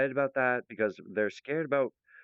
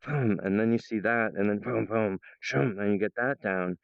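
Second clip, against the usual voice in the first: ordinary talk, nothing marked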